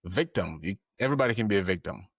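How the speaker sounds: usual speaking voice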